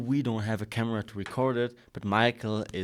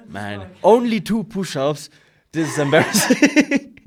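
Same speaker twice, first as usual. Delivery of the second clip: teasing laugh; 6 semitones higher, 10 dB louder than usual. Real, not acted. Teasing friend's push-ups.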